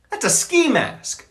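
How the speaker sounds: dismayed realisation. Real, not acted. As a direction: spell out the ski mask in horror. Realising his beanie is ski mask.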